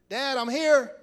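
loud, high arrival call. Acted. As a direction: sing out to Dad on coming in. Esau arrives calling to his father.

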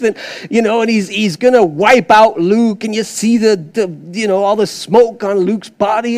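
ordinary delivery

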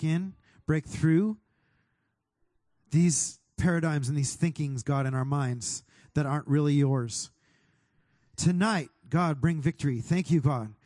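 fervent prayer, declaring and thanking. Acted. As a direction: lift it up like a worship leader praying. Prays aloud over the room.

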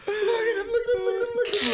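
very high, squealing, re-enacted hysterics; screaming with laughter. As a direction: shout through helpless cackles, almost crying. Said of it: "screaming, trying to hold my mouth because I'm laughing so hard".

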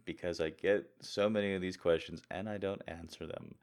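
ordinary talk, nothing marked

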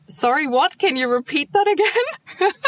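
lightly mimicked puzzled "sorry, what?", rising high at the end; confused asking. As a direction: play the lost newcomer who didn't catch a word, going up high. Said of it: "you can ask, like".

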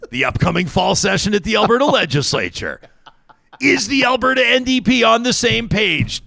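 high, dramatic mock-investigative question; hammed-up high-pitched suspicion; mock exposé. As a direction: climb high as if exposing a scandal. Mock exposé of political plot.

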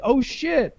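high startled crow voice; alarmed crow. Retold or imitated. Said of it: "the crows that are nearby, it's like".

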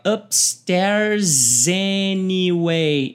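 very slow "Upstairs anyway" with the s running into "anyway"; linking demo; linked words. Shown as a demonstration. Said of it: "presta atenção aqui".

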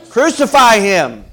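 loud, high, drawn-out shout; slow, full-voiced acted crowd shout; crowd's cry. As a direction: cry out like a mob demanding a death. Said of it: "what were they crying?".

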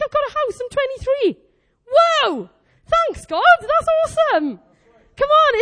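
high, excited exclaiming; thrilled gratitude. Acted. Preacher thanking God for a house at twenty-three, thrilled. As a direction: burst out in amazed thanks, high and loud.

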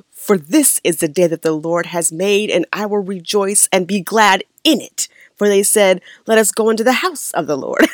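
swooping Baptist preacher's recitation; churchy pastor inflections. Retold or imitated. Speaker imitates the reverend from back home, churchy preacher inflections. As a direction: declaim it with an old-church pastor's lilt. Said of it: "I say it in his voice".

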